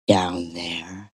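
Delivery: hushed mock-ad whisper. Mocking a lactation ad, whispering the tagline. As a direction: breathe it like a secret.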